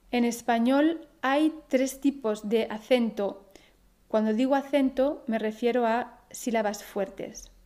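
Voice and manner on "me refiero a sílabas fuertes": normal talking voice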